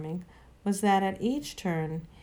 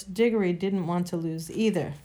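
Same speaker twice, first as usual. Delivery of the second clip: usual speaking voice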